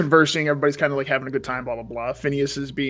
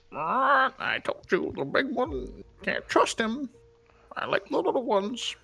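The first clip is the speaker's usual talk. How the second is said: high, hushed, creepy experimenter voice; creepy Horace; 10 semitones higher, 9 dB quieter, slower than usual. Acted. GM voicing Horace to the players, a high hushed creepy voice. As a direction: savor the word experiments in a high hush. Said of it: "Horace will say to you".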